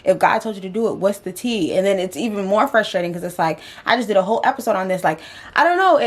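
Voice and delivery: ordinary talk, nothing marked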